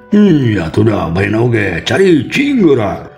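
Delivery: high, slow, drawn-out reproach; scolding. Acted. Village head scolds Mang Uman.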